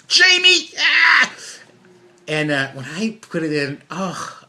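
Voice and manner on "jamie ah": a much higher, louder yelp at your own name; mock frustrated shout. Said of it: "They're talking to themselves".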